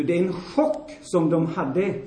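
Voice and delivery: ordinary delivery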